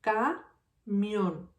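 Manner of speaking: "Camión" split as ca, mión; a clear pause between ca and mión in "Camión"; syllable by syllable. Shown as a demonstration. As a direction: say "Camión" in two separate beats. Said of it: "This word has two syllables".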